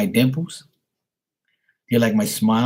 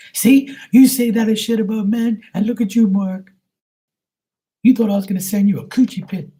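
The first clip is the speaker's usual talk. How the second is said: much higher put-on voice, mock indignant; put-on teasing voice; 11 semitones higher, 3 dB louder than usual. Voicing a viewer calling out Mark, high mock indignant voice. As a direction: squeak it back at him in mock outrage.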